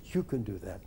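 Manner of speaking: low, hushed, repeated encouragement; soft, deep, quietly convinced; inner voices. Retold or imitated. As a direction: murmur it like a thought dawning. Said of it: "A thousand voices whirled over my head".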